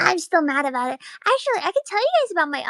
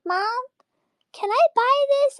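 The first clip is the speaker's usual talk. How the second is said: put-on pleading child; 10 semitones higher than usual. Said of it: "I was like".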